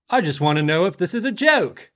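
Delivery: high, loud retold outburst; mock angry demand. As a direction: imitate a diner yelling at staff. Rude diner yells at waitress again.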